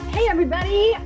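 ordinary delivery

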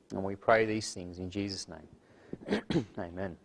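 soft, reverent prayer, hushed at the amen. Acted. Pastor opens the evening service with prayer, soft and reverent. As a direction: pray humbly before the Lord.

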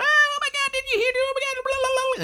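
very high, squealing panicked friend voice; high-pitched hysterical gossip; frantic screaming friend. Retold or imitated. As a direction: shriek it like a friend losing it on the phone. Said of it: "who is screaming on the phone".

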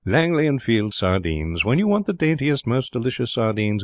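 measured, emphatic ad copy recital; earnest advertisement reading. A narrator's reading. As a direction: read the advert aloud with swelling pitch on key phrases. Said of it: "Martin began to read".

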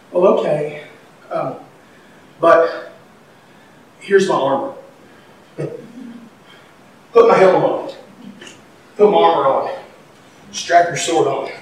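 soft, low hesitant offer; hesitant king. Acted. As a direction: offer it unsure, trailing. Saul hesitantly offering armor.

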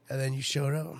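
ordinary talk

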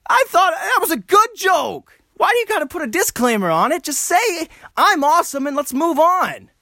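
very high, squeaky protest; mock indignant comedian. Comedian pleading after a joke is dismissed, mock indignant. Acting out a wounded joke-teller.

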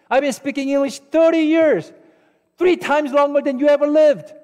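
mock-indignant dad scolding, high-pitched; offended outburst. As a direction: play an outraged father telling off his daughter. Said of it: "so offended".

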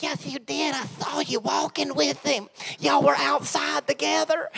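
put-on a Southern twang for the girl; country accent. Girl accusing Peter in a country accent. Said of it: "she has a country accent".

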